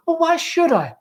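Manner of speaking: put-on very high, whiny girl's voice; defiant Laura. Laura refusing her mother, very high whiny protest. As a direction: push back like a sulky daughter.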